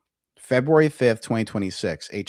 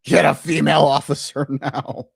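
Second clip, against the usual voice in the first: loud, higher mocking echo of the shout; 5 semitones higher, 6 dB louder than usual. Commentator loudly parroting the arrested lawyer's demand, mocking shout. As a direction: throw the line back, yelling it in mockery.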